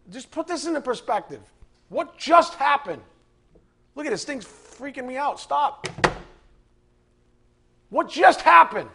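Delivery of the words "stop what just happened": usual speaking voice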